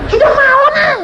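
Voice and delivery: high, loud late-night refusal; annoyed refusal. Retold or imitated. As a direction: brush him off, it's late. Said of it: "saya bilang". (menolak dengan suara tinggi dan keras)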